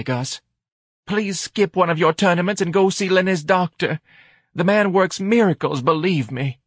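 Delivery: high-pitched, dramatic diagnosis; knowing wife. A narrator's reading. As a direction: lecture the husband with theatrical concern. Wife diagnoses husband's guilt.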